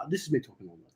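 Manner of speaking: soft, hushed demonstration; talking quietly. Shown as a demonstration. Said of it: "just talk normally".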